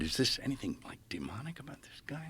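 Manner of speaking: hushed, whispered worry; worried whisper. Retold or imitated. Asking the priest about the healer, whispered worry. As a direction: confide it under your breath.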